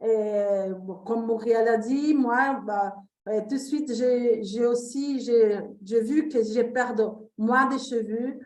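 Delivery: Brazilian accent in French; French spoken with a Brazilian accent; foreign accent in french. Real, not acted. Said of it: "mon français, c'est pas très bien". (accent brésilien en français)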